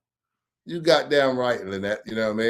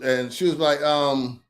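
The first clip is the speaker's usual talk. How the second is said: normal talking voice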